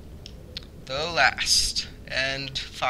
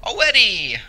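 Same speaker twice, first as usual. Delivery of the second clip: loud exclamation; 5 semitones higher, 6 dB louder than usual. Pretend. Answers his own question loudly.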